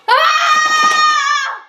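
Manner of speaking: loud exasperated cry; frustrated yell. Acted. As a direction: let out a fed-up yell. Frustrated outburst after call.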